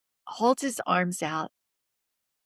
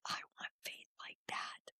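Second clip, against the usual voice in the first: very soft whispered wish; hushed inner resolve, barely audible; awed whisper; 19 dB quieter than usual. Retold or imitated. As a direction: whisper it like a private prayer. Retelling a private inner resolve.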